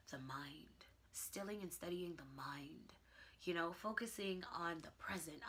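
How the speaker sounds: whispered, hushed and soft; calm whisper. Real, not acted. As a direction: whisper it, as if meditating. Describing stilling the mind.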